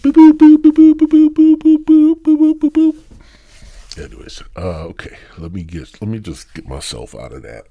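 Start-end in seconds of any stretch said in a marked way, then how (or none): 0.0-4.0 high, loud electronic boops; be a beeping machine; boop sounds. Pretend. Mimicking an alarm or device with loud high boops.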